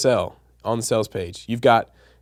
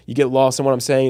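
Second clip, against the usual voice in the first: usual speaking voice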